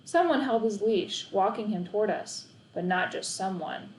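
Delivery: usual speaking voice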